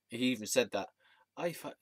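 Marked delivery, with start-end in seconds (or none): 1.3-1.7 higher-pitched attempt at Scottish; put-on Scottish voice; Scottish accent. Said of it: "trying to do my Scottish accent".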